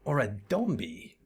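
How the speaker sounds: a light, put-on voice for a small companion pondering zombies; curious little character voice. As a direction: ask it like a puzzled little sidekick. Voices Nashu musing.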